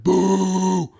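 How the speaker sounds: loud mock crowd boo. Imitating the hostile audience booing Ayn Rand on stage, loud.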